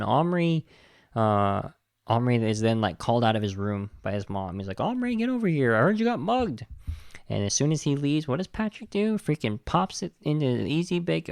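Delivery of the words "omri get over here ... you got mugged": high-pitched mock scolding mom; imitated mom, pitched way up. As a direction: call the kid over like an exasperated mother. Voicing mom calling him out.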